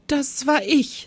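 high, slow, sheepish little-man voice; embarrassed. A narrator's reading. Teacher confesses to director, sheepish and high. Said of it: "mit verlegenem Lächeln".

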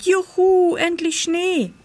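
put-on high, jubilant child's cheer; excited cry. A narrator's reading. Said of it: "rief Pitt". (hoher, begeisterter Jubelruf)

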